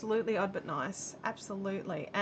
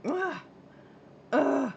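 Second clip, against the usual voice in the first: higher mock teen groan of disgust; teenager grunty groan; 4 semitones higher than usual. Daughter refusing to pay, mock teenage groan. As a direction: groan like an eye-rolling teen. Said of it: "the teenager grunty groan".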